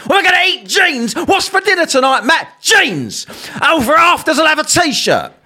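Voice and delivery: high silly put-on voices; hammed-up mock family dinner; mocking skit. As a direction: play out a ridiculous dinner scene. Mock dinner dialogue about jeans.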